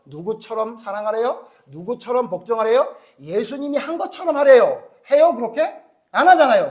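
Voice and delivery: loud, driving rebuke from the pulpit; preaching at full force. Acted. Preacher rebuking his congregation, loud and forceful. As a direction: hammer the point home to the pews.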